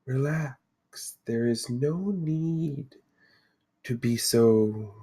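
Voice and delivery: slow, soft, soothing seer; serene Lady of the Lake. Acted. Mystic lady to the wary party, serenely calming. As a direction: soothe them softly, with long pauses. Game master voices the Lady.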